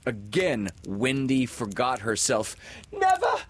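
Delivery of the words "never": very high, loud outburst; defiant cry. A narrator's reading. Wendy forgetting herself as she overhears the plot, crying out. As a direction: shoot the voice way up, loud. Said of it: "she cried".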